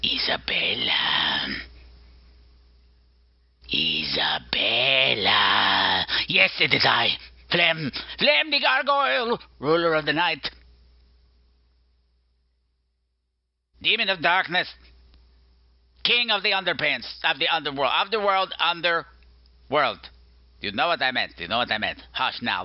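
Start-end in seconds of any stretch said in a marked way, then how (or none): 0.0-6.1 long, drawn-out eerie call; slow, ghostly wail of a name; spooky gargoyle summons. Pretend. Gargoyle character makes spooky entrance. 6.3-20.0 raised-pitch, bombastic self-announcement; hammed-up monster fanfare; grandiose gargoyle. Gargoyle announces his grand titles.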